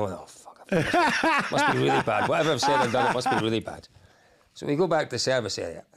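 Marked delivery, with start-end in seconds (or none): none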